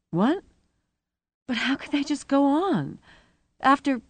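high-pitched, baffled question; incredulous. A narrator's reading. Rosie blinking at news the day goes on, incredulous. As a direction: ask it in startled disbelief.

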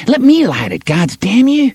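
put-on high, bratty boy cursing; cocky street boy. Boy bossing his gang, high and bratty. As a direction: swagger and snap like a little gang boss. Boy demands to light fuse.

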